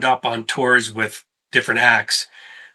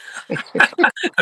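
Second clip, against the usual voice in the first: high breathy laugh. Real, not acted. Laughing at the end of the story.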